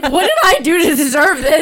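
father lamenting his son, laughing; laughing dad imitation. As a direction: play a dismayed father while laughing. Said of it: "He's like".

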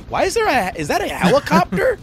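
high puzzled questioning voice; puzzled kids. Acted. Kids in crowd spotting the helicopter, high puzzled voices. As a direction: wonder aloud at the helicopter. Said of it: "a couple people are like".

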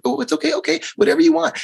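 sarcastic sweet giving in; mocking parents. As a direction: ham up the spineless parent. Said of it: "these parents were like".

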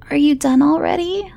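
put-on high, drowsy child voice; a tired kid up past bedtime; sleepy little boy. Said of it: "Jacob asked, rubbing one eye".

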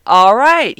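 a big, drawn-up reaction to a good joke; pleasantly impressed. Retold or imitated. Telling how his joke won her over, pleasantly impressed.

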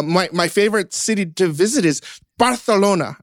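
Barcelona said bar-thelona with a Spanish th; mock Castilian Barcelona, bar-the-LO-na; Spanish lisp. Host imitating a friend's Castilian Barcelona, bar-the-LO-na. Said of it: "somebody just is like".

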